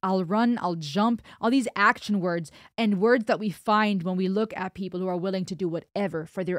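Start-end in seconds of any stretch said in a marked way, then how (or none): none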